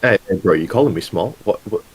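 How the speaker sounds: ordinary delivery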